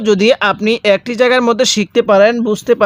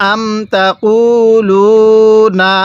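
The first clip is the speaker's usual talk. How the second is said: drawn-out chant for students to repeat; teacher reciting for repetition; slow recitation; much slower than usual. Shown as a demonstration. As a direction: model the verse slowly. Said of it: "তাহলে আমার সাথে পড়ুন".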